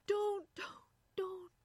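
high, pleading moan; distressed, halting plea. A narrator's reading. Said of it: "she moaned".

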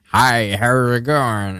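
slow, overdone fake Southern drawl from a Northerner; bad Southern accent. Mocking Northerners who butcher the Southern accent, slow fake drawl. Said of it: "Northerners do it and they just butcher it".

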